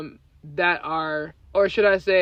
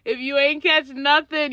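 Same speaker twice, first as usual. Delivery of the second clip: ordinary talk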